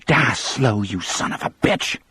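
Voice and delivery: put-on a hissed, spiteful curse from the old mother; mild hammed-up venom; spat curse. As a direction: spit the words at the condemned man. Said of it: "the woman spat".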